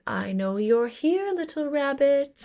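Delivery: slow, drawn-out predator taunt, lifting on the last words; lightly acted singsong hunter; menacing taunt. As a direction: toy with your prey, slowly. Villain's taunt in retold scene.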